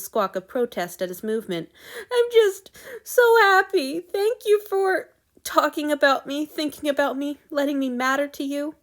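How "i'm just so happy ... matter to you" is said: sobbing out thanks, high and loud then easing; overwhelmed with grateful tears; happy crying. A narrator's reading. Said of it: "the hot tears".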